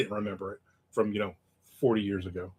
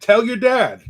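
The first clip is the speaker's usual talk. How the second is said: high, loud stern mother; stern mom; 10 semitones higher, 9 dB louder, slower than usual. Retold or imitated. Mother ordering her son to confess to dad, high and loud. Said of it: "And she said".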